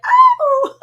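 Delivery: very high, loud startled oh; shocked oh. Retold or imitated. Mom hears it's a boy, loud high startled oh. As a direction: caught off guard by the news. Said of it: "and I'm like".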